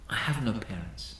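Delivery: quiet admission. Retold or imitated. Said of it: "He said".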